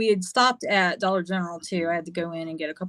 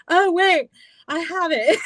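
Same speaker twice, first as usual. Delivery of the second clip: high, louder, laughing at herself; sheepish realization; 10 semitones higher, 5 dB louder than usual. Real, not acted. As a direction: catch your own mistake and laugh it off.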